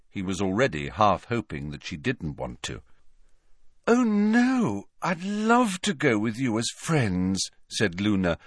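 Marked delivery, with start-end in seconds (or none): 3.9-7.5 put-on high, delighted girl's voice; beaming delight. A narrator's reading. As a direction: accept happily with an audible beam. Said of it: "beaming as he had never seen her beam before".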